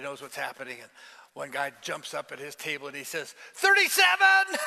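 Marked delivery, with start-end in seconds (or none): 3.5-4.6 called out high and loud, slow; shouted number. Retold or imitated. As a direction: yell it across the canteen. Prisoner calls out a number.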